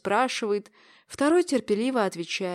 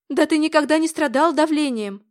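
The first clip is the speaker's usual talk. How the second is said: громко и высоко, возражая; горячо возразить; взволнованно; 6 semitones higher, 6 dB louder than usual. A narrator's reading. Дочь возражает отцу в тревоге. (дочь взволнованно спорит с отцом)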